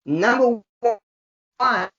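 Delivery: slow drawn-out reveal; dramatic countdown announcer. Acted. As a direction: stretch it out like a big reveal.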